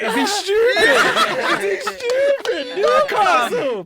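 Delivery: high, laughing squeal of is he stupid; laughing mock disbelief. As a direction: squeal through laughter at the joke. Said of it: "That look was like".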